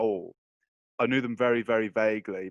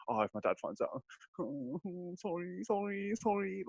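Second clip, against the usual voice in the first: mock terrified; 8 semitones higher, 9 dB quieter than usual. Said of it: "at the time I was terrified".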